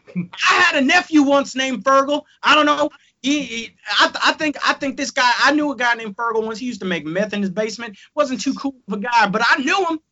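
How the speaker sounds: high, hammed-up Texan; horrendous Texas accent. Texan fan reminiscing about Fergal, high mock Texas accent. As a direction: ramble as a folksy bad Texan. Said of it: "my horrendous Texas accent".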